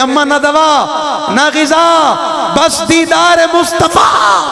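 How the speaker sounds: yearning shout building from plea to slow cry; anguished cry. Retold or imitated. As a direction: cry out in longing, stretching the last word slowly. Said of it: "صدیق تڑپ کے کہتے ہیں".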